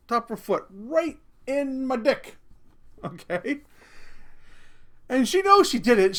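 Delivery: usual speaking voice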